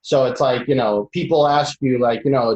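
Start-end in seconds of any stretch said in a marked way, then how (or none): none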